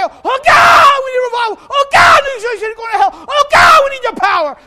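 high, loud fervent crying to God; roaring revival prayer; shouted prayer. Retold or imitated. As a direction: pray at full force for revival. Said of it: "we will get in there and pray".

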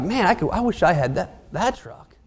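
breathy covetous murmur; soft wistful longing. Retold or imitated. Preacher voicing a man envying a truck, soft and wistful. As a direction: drop low and soft, sighing over the truck.

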